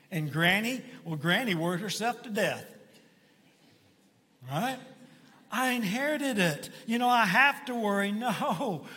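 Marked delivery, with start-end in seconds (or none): 5.5-8.1 a higher, mocking singsong of the excuse; mock whiny excuse. Mocking a churchgoer who blames family, a touch whiny. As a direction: parody the worrier, not quite full voice.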